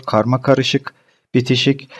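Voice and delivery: ordinary talk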